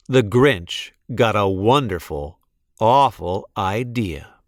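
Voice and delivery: ordinary delivery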